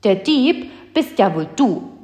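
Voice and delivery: a defiant retort. Acted. Mathilda talks back to the giant, defiant. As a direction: throw it back at him defiantly. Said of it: "sagte sie trotzig".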